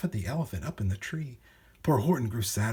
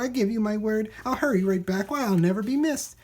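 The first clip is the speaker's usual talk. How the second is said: pleading bird; 8 semitones higher, 3 dB louder than usual. Acted. Bird Maisie begging the elephant.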